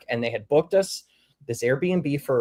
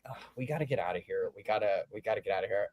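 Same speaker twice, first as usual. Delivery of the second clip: low urgent; 7 semitones lower, 10 dB quieter, faster than usual. Retold or imitated. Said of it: "really kinda like".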